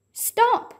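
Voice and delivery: very high sharp shout; urgent shout. Acted. Jack shouting to Annie as arrows fly. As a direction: make her stop at once. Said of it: "Jack shouted to Annie".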